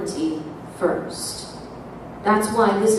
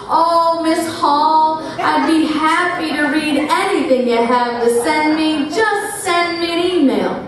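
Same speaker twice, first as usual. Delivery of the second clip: higher, sing-song and overly polite; sudden sweetness; 6 semitones higher than usual. Retold or imitated. Senator sweet-talking her before reporters, sing-song. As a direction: switch to fake charm for the cameras. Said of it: "His whole demeanor changes".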